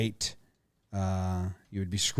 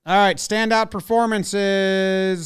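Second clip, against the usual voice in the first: ESPN announcer voice; 8 semitones higher, 7 dB louder, slower than usual. Acted. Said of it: "the old E S P N countdown voice".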